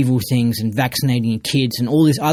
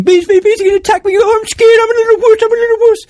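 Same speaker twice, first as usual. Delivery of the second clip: much higher, louder whiny mock fear; mock wuss; 8 dB louder, faster than usual. Mocking cowards near bees, much higher whiny panic. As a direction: whimper like a scared little wuss. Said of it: "I wouldn't be going".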